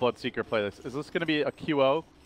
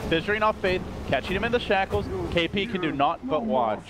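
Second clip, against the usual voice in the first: raised, driving live fight commentary; excited play-by-play; 6 semitones higher than usual. Acted. Caster to viewers during a team fight, excited play-by-play. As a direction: call the team fight at full tilt, pitched up.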